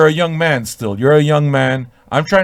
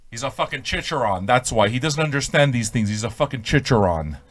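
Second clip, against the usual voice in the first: ordinary delivery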